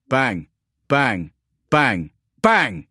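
separate, punchy bangs, each falling away; voiced gunshots. A narrator's reading. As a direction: hit each bang hard, with a gap after it. Narrator voices spaced gunfire bangs.

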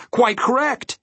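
very high, approving character voice; approving dodecahedron. A narrator's reading. Dodecahedron to Milo after his answer, approving. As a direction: confirm it high and assured. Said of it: "he nodded".

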